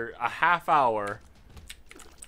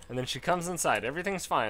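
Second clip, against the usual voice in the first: ordinary delivery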